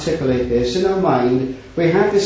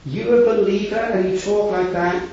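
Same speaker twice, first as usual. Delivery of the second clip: mock disdain; 5 semitones higher than usual. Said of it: "how the Christians would disdain us".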